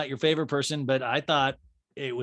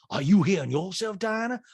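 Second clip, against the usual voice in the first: lightly imitated comeback, slightly raised; Sutton; 6 semitones higher, 4 dB louder than usual. Sutton throwing the question back at Diana, mild mimicry. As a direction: turn the question back on her. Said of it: "Son goes".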